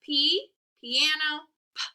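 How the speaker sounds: slow chant of "P, piano, p", ending on a bare puff; name "P", say "piano", then pop a voiceless "p"; letter, word, sound. Shown as a demonstration. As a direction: drill "P, piano, p" in separate beats. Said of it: "let's do our letter word sound".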